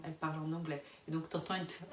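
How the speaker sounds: ordinary talk, nothing marked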